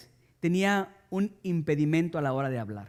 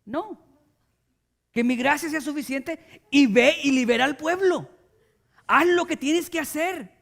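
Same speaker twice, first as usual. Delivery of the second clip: God commanding; 7 semitones higher, 3 dB louder than usual. Retold or imitated. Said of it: "Dios lo voltea a ver y le dice".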